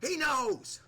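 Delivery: high, loud hammed-up wrestler trash talk; The Rock. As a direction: shout it like a cocky champion on the mic. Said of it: "The Rock, what do you have to say about it?".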